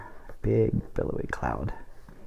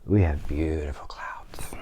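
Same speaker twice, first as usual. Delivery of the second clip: whispered; 4 semitones lower, slower than usual. Real, not acted. Illustrator admiring clouds, whispered. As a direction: breathe it out in wonder.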